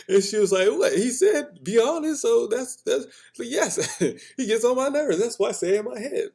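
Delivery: high, loud animated telling; excited retelling. Real, not acted. Recounting the exchange, high and excited. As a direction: tell it all worked up.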